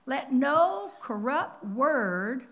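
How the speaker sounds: slow, loud, drawn-out scripture line; booming preaching. Acted. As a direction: thunder each word at the congregation.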